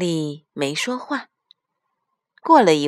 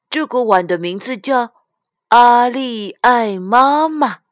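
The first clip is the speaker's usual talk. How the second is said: louder, higher, stretching out the name; proud child; 6 semitones higher, 6 dB louder than usual. A narrator's reading. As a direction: announce it to mum like a delighted little girl. Child proudly names the bowl. (小女孩得意地拖长声宣布)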